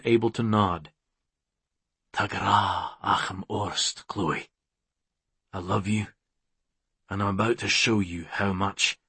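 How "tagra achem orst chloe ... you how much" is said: low, soft lover's murmur; tender declaration. Acted. McCreeve tells Chloe he loves her, tender and low. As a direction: drop low and quiet, full of love.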